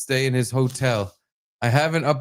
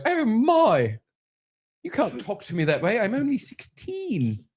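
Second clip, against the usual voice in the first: put-on high, prim English girl protesting; girl's English accent; 9 semitones higher than usual. Voicing the girl's protest in a high English accent. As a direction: object in a high English accent, slowing at the end. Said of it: "I'll do the English accent for the girl".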